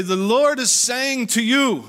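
high, loud, slow proclamation in the prophet's voice; imitating the prophet. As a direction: pronounce it slowly like a visiting prophet. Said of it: "and he'd say".

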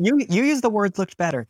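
offended, loud sputter; indignant outburst. Acted. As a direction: pounce on the words she used. Nia indignant at the comparison.

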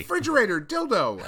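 high, loud, commanding the fridge to change; yelling the magic word at a fridge; mock excited shout. Teen boy shouting at his fridge, mock command. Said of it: "you'd be like".

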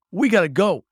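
loud, urgent call to flee; urgent shout. Acted. Hero yelling at the Raven to run, urgent shout. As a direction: shout it to get everyone moving. Urgent escape, grabbing cousin.

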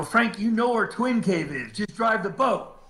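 high-pitched exasperated urging; acted-out impatient command; impatient retold order. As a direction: urge him on, pitch jumped up.

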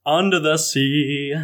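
slow sung Jamaican accent. Shown as a demonstration. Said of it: "[name], please do your Jamaican accent".

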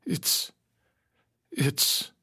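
halting, overwhelmed stammer; trembling father. A narrator's reading. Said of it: "my father was trembling".

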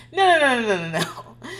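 sharp, high burst of no's; loud refusal. Retold or imitated. Mom shutting down her son's lie, loud. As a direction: cut him off hard.

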